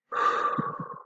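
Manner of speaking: long audible breath out; a deep, audible calming exhale. Shown as a demonstration. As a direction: let the breath go slowly. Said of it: "take some deep breaths".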